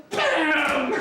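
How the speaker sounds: loud, high impact noise; imitated slam. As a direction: boom out the crash of a fist on a table. Imitates the table being slammed.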